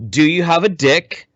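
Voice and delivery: ordinary talk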